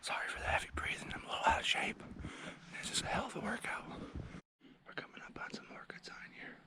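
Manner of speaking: hushed whisper while stalking. Real, not acted. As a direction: whisper so the deer can't hear.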